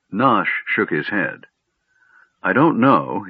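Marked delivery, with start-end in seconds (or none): none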